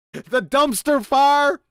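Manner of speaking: ordinary talk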